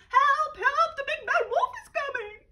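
very high, loud panicked cry; panicked squeal. Acted. Little pig fleeing to his brother, panicked squeal. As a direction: squeal for help while running.

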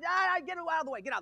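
high strained mock yell that drops away; acted yelling. Preacher acting out a lifetime of yelling at troubles, fading mock yell. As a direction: play at yelling, then lose steam. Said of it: "yell at them all my life".

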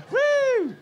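high, real whoop of joy; cheering the crowd on; excited whoop. Real, not acted. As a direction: let out a happy woo.

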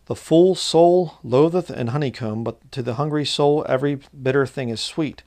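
loud, measured reading of a proverb; solemn preacher's scripture reading. Acted. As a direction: proclaim the verse to the congregation. Reads scripture aloud.

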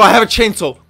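ordinary talk, nothing marked

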